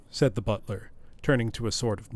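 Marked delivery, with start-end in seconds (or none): none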